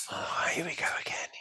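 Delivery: soft, sighing resignation; weary resignation. Retold or imitated. Patient retelling going back into hospital, resigned. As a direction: breathe it out like a weary sigh.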